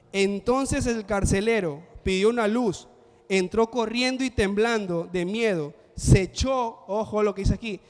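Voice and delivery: lectura de las Escrituras, más fuerte y pausada; lectura bíblica. Acted. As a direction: proclamar el pasaje ante la congregación. Lee Hechos 16 en voz alta. (el predicador lee Hechos a la congregación, más fuerte y pausado)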